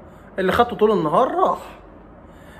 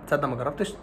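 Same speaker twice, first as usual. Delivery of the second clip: a quieter, casual student excuse; softer student voice; 5 semitones lower, 6 dB quieter than usual. Retold or imitated. Student brushing off the doctor's advice, softly. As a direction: shrug it off like a cocky pupil.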